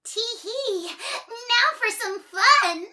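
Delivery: very high, loud, slow giggly voice; mischievous giggle. Acted. Miss Trouble plotting mischief, giggling high and gleeful. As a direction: cackle with naughty glee. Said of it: "giggled Miss Trouble".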